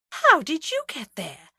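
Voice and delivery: slow bewildered demand; puzzled, pressing question. A narrator's reading. Agatha to Hodge, bewildered and demanding. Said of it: "demanded Agatha, bewildered".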